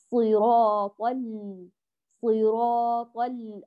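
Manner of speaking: slow recitation, first L of ال- sounding briefly; measured first lam. Shown as a demonstration. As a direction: recite صراط ال- slowly and stop on the L. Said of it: "تعطي اللام الأولى جريان جزئي".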